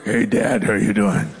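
low put-on big-brother voice. Jacob fakes his brother's voice to fool his blind father, low and put-on. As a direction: drop the voice low to pass as Esau. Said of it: "tries to imitate the voice of his brother, who I imagine was deeper".

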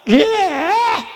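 sudden high yelled yeah; hollering yeah. Retold or imitated. Preacher imitating the boy crying out mid-sermon, high yell. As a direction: holler it out like the kid who can't help it. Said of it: "would be hollering out".

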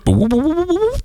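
rising, high-pitched whoops; imitated power-up sound. Showing Mario growing after the mushroom, whoops climbing high. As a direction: climb in quick game-like whoops. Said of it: "dan ben je groter".

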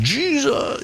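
strained high dream cry; imitating a muffled cry from a dream. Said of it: "this muffled".

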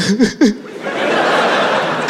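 breathy fake sobbing; hammed-up weeping sound; mock crying. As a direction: imitate the brother crying without words. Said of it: "Like, crying".

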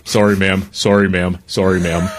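low, timid apology; meek manager. Retold or imitated. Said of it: "And just go".

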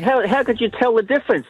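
a touch of surprise, pitch lifted; surprised. Acted. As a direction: ask it in lightly acted puzzlement. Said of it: "a bit of a surprised look on her face".

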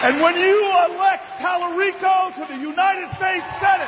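roaring endorsement; rally shout. Acted. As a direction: shout the name to the crowd.

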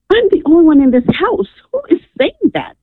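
high-pitched, incredulous question; bewildered retort. Retold or imitated. Caller answering the mysterious voice alone at home, bewildered. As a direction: protest in a high, puzzled voice.

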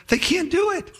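thin high-pitched mocking dismissal; undermining jeer. Sanballat undermining the builders' confidence, jeering. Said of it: "He undermines their confidence".

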